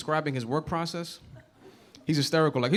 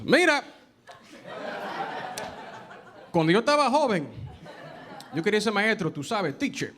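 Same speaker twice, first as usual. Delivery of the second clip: Spanish-speaking dad; 5 semitones higher than usual. Retold or imitated. Dad telling son his childhood dream, Spanish-speaking father voice. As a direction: play the dad switching to English at the end. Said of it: "that's how he said it".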